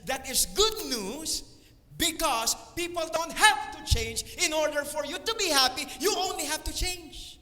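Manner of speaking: high-pitched, emphatic exhortation; impassioned motivational preaching. Real, not acted. Speaker urging listeners to choose their happiness, impassioned preaching. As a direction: drive the good news home with rising fervour.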